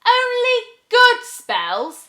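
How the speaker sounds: very high, loud, slow scornful question; huffy hiss. Acted. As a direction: scoff in outrage. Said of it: "hissed the witch in a huff".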